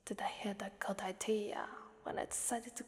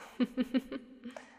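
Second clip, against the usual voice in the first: amused villainous chuckle; wicked chuckle; 3 semitones higher, 4 dB louder than usual. Acted. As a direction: chuckle at their coming danger. Amused at the servant's danger.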